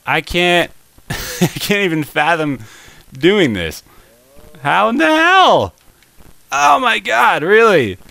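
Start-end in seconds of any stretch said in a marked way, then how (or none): 4.6-8.0 high, loud incredulous outburst; real frustration. Real, not acted. Gamer baffled by a failed obstacle, high frustrated outburst. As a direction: cry out in exasperated disbelief.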